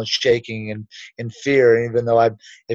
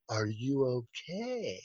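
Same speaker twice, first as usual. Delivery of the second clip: very soft, slow, lower check-in; hushed concern; 4 semitones lower, 16 dB quieter, slower than usual. Retold or imitated. He goes over to the fallen man, hushed. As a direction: act out gently asking after someone collapsed.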